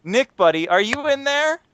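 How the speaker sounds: gently coaxing, rising at the end; coaxing calm voice. Retold or imitated. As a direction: coax him out like a skittish cat. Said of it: "like he's trying to call a skittish cat voice".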